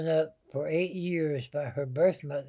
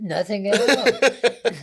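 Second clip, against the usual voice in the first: Nothing at all drawn out slowly and louder, higher; slow emphatic repeat; 7 semitones higher, 9 dB louder, much slower than usual. Retold or imitated. Answering a puzzled friend again, slow and emphatic. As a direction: spell it out slowly, a bit exasperated.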